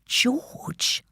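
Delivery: breathless whisper, barely there; mouthed. A narrator's reading. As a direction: mouth the name in disbelief. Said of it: "I mouthed at my mum".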